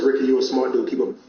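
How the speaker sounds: ordinary talk, nothing marked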